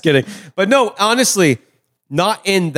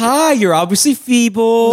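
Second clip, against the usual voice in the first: high, slow mock-kindly greeting; mock sweet condescension; talking down to the elderly; 6 semitones higher, slower than usual. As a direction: coo slowly, as if to someone frail. Mocks talking to elderly people.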